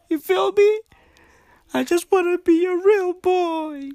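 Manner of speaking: very high, put-on little boy voice; high-pitched playful pleading; mock childlike plea. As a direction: climb into a squeaky, pretend plea.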